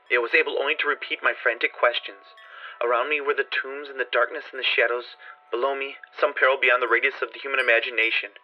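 usual speaking voice

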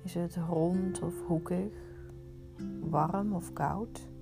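normal talking voice

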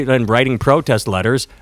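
normal talking voice

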